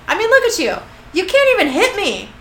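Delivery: high, loud goading; taunting. Acted. Metatron goads Castiel who will not hit him, taunting. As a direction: needle them into throwing a punch.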